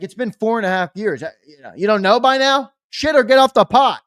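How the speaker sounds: rising to an exasperated shout; exasperated rant. Retold or imitated. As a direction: build from fed up to yelling. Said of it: "I'm like".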